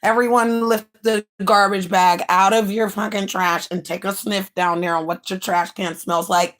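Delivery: ordinary delivery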